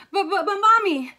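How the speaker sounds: put-on high, loud wheedling kid; whiny childlike plea; pleading stammer. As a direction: beg mom with stuttered protest. Gabby pleads with mother.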